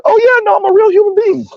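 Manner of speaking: high-pitched goofy voice, joking with his lady; high silly voice. Retold or imitated. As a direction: squeak it out like a goofball. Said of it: "I was like".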